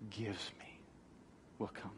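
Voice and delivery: 突然压低成耳语; sudden whisper. Acted. Emphasis drops to whisper.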